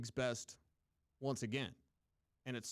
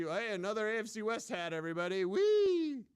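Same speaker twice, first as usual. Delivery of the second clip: higher and higher, ending in a loud squealed whee; mocking cheer; 7 semitones higher, 4 dB louder than usual. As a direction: ham up a fan cheering a division hat. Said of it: "we'd make fun of".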